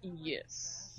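low, quiet odd voice; deep muttered joke voice; strange put-on voice. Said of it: "Sorry, I'm still in that voice".

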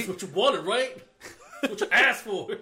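loud tough street-guy voice; thug voice. Retold or imitated. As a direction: bark it like a thug. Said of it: "Why did he say it so, like, thug?".

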